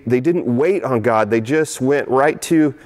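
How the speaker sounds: ordinary delivery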